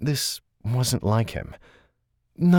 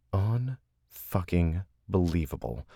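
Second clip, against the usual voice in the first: ordinary delivery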